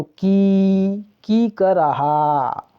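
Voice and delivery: की held long and slow; teacher slowly sounding out long की; pronunciation demo. Shown as a demonstration. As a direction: stretch the ee in की, then draw out कर रहा. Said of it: "उच्चारण करते हैं तो".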